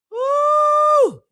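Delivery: high, loud celebratory whoop; hype whoop. Real, not acted. Host whooping to fire up her viewers, loud and high. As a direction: let out a big excited woo.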